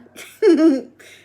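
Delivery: loud amused giggle. Real, not acted. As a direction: burst into a real giggle. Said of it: "That kinda makes me giggle".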